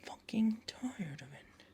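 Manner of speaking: half-whispered, weary gripe; strained, hushed complaint; hushed exasperation. Real, not acted. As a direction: grumble it softly under your breath. Repeats complaint under their breath.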